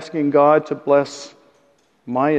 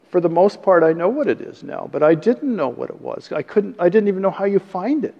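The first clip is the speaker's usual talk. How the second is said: ordinary talk, nothing marked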